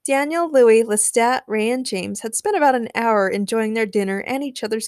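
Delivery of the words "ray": usual speaking voice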